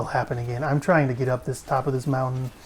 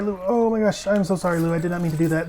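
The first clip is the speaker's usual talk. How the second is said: normal talking voice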